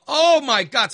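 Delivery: loud high startled cry; scared exclamation. Retold or imitated. Son frightened by mother in the house, high loud startled. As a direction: blurt it in fright. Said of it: "it scares me to death".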